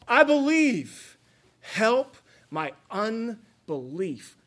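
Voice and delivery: high, loud, slow cry of I believe; a father begging, slowed and pleading; desperate plea. Retold or imitated. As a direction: plead it out, voice straining upward.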